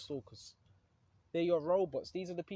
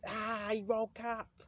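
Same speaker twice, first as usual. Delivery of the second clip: a higher, drawn-out hint of an angry Satan; slow, pitched-up mock devil groaning that you woke up; Satan in the spiritual realm; 6 semitones higher, slower than usual. Preacher voicing Satan finding a believer awake, slow and higher. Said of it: "Satan screaming in the spiritual realm".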